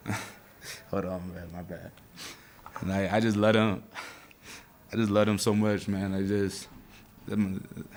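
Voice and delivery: fighting tears while talking about his dad; holding back tears. Real, not acted. Player thanking family on interview, choking back tears. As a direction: voice choking up, quiet and broken. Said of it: "I'm trying not to cry".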